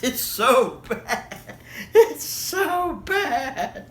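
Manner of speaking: high, drawn-out laughing wail; helpless with laughter. Real, not acted. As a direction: stretch it out while cracking up.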